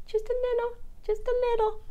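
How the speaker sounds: high, soft Just a little; sing-song high. Acted. Vlogger coos while drizzling sesame oil, high and soft.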